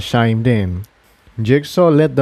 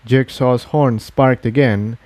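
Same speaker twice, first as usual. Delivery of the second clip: ordinary delivery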